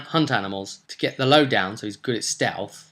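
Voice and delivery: ordinary delivery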